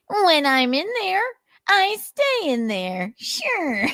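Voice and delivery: high, slow mocking voice; sing-song it in a squeaky sneer; Nick impression. Narrator voicing Nick dodging the officer, high and mocking. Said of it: "Nick's impression".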